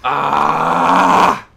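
loud yell. Real, not acted. Outburst mid-rap.

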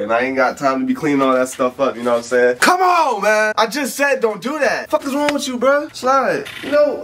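ordinary talk, nothing marked

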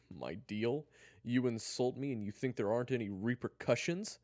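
loud, high indignant questioning; incredulous challenge. Acted. Kyler confronts Eric over the insult, indignant. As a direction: challenge him in disbelief. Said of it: "Kyler chuckled".

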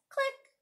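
high, quick clicking sound; little high-pitched camera click. A narrator's reading. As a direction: chirp a tiny shutter click.

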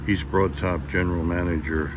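normal talking voice

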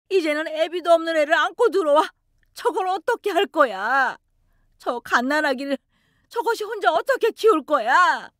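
high, grieving mother's outcry; a touch of wailing grief; lamenting. Acted. Mother lamenting to her daughter-in-law, grieving outcry. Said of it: "통곡을 하셨습니다".

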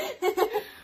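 bright burst of giggling; high giggle. Real, not acted. Vlogger giggling at her friend's reaction. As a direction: let a high laugh pop out.

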